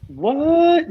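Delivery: high, loud shout of surprise; loud, delighted disbelief; surprised. Real, not acted. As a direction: react with real, high-pitched astonishment. Spots a Power Pack poster.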